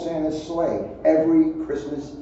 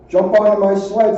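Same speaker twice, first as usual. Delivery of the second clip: loud, urgent call to climb aboard; hearty call; 4 semitones higher, 7 dB louder than usual. A narrator's reading. Prospector calling the runaways onto his sled, loud and urgent. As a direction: shout it out like a bold prospector. Cornelius urging friends to flee.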